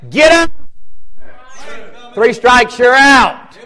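God yelling at the fool; shouting. Pretend. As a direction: bellow the warning. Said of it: "And the Lord said".

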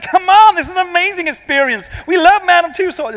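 high, loud mock American accent; hammed-up American tourist enthusiasm. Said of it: "Sorry about the accent".

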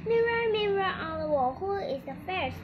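high, loud call to the magic mirror; queen's incantation. Acted. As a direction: invoke the mirror, falling away towards the end. Queen invoking her magic mirror.

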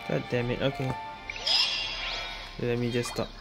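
ordinary delivery

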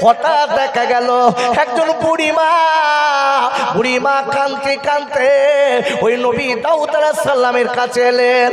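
chanted sermon storytelling; sing-song preacher's waz, full voice; rhythmic, stretched-out sermon chant; preaching. Acted.

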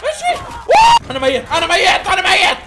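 very high, loud screaming panic; panicked shout. Real, not acted. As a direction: yell in real fright at the tigers. Tiger round panic. (صراخ مذعور عالٍ جدًا)

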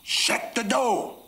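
high, drawn-out angry shout. Acted. Mad father repeating his order to the child, shouting. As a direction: bellow it slowly, pitch leaping right up. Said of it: "I said a little louder".

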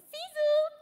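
high, sung-out goodbye; coy farewell. Acted. Woman taking leave of her idol, coy. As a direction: pitch it way up, playfully.

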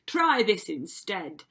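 loud, slow yell; yelled retort. A narrator's reading. Prince answering the second sister with his sword, yelled retort. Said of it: "the prince yelled back".

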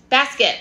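Basket said clearly, stress on bas; slow teacher model of Basket; model pronunciation. Shown as a demonstration. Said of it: "So say it with me".

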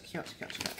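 soft unvoiced cooing whisper. Real, not acted. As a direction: breathe the words out in delight. Cooing over a cute bag.

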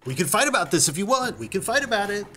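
quick high little-dog challenge; scrappy terrier taunt. Acted. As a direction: rattle it off like a terrier spoiling for a scrap. Terrier snapping and barking.

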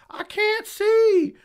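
high, drawn-out imitation scream; mock scream. Mimicking a poked fighter on the ground, mock scream. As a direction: wail it loud and high, mocking. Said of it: "and screams".

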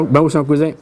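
usual speaking voice

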